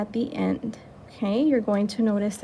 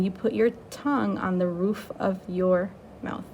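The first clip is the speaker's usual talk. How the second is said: ordinary talk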